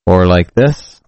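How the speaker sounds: electronically altered voice; pitch-shifted voice. Shown as a demonstration. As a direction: voice changer on, other timbre. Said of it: "I can change my gender pitch".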